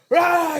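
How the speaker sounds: loud, high "rah" burst; screamed roar. Retold or imitated. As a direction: roar like an amped-up madman. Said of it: "he was screaming and yelling".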